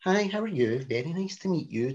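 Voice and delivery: soft, careful, well-mannered greeting; mock-polite and quiet, starting high; polite phone voice. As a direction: imitate your own polite, toned-down voice. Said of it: "you go from being".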